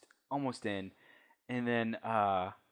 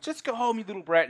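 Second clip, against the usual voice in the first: high, loud shout of go home; a raised, shrill-pitched woman's yell; screaming woman; 9 semitones higher, 6 dB louder than usual. Acted. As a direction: scream her out the door. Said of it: "She, like, screams".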